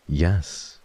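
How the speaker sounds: usual speaking voice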